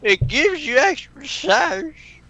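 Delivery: drawn-out squeaky Pinkie; high character voice. Acted.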